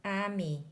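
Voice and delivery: "ami" said on its own as a model; French pronunciation. Shown as a demonstration. Narrator teaches the fancy word ami, careful French pronunciation. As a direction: show how to say "ami". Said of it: "You say it like this".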